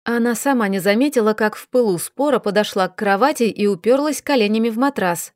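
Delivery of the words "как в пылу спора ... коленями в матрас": plain reading voice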